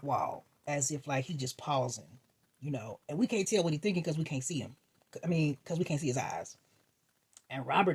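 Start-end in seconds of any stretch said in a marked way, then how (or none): none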